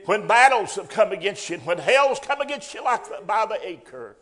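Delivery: loud rising sermon cry; preaching at full force. Acted. Preacher rallying the congregation, loud and rising. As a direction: lift the church with it.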